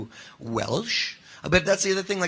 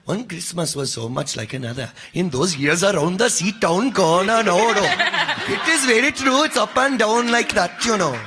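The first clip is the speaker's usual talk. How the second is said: bad Punjabi accent; 4 semitones higher, 4 dB louder than usual. Shown as a demonstration. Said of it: "Just do a bad Punjab, Punjabi accent".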